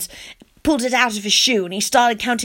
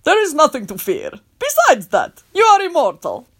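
disgusted; 5 semitones higher than usual. Acted. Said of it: "sounded kind of disgusted".

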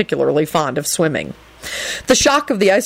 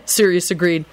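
ordinary delivery, nothing marked

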